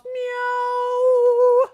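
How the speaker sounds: very high, loud meow; cat meow. Pretend. Imitating a cat.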